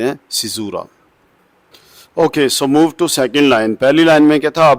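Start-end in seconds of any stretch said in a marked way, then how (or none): none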